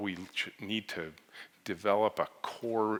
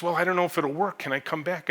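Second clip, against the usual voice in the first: anxious plea; 10 semitones higher, 5 dB louder than usual. Retold or imitated. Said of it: "She goes".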